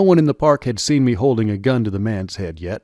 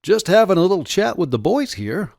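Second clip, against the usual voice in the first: higher-pitched, innocent reply; feigned innocence; 8 semitones higher than usual. A narrator's reading. Man fobs off the Russian soldier, feigning innocence. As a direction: play innocent, voice lifted high. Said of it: "I replied as innocently as I could".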